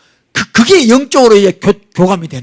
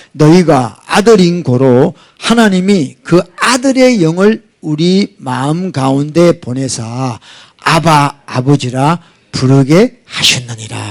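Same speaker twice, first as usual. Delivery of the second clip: slow, deliberate Bible reading; measured pulpit reading of a verse; slow scripture reading; 4 semitones lower, slower than usual. Acted. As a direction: read each word of the verse slowly and solemnly. Scripture read slowly.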